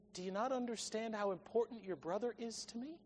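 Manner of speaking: very soft, earnest question; a father quietly appealing to his son; hushed appeal. Acted.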